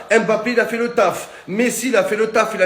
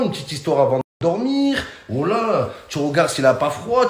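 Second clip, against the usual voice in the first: normal talking voice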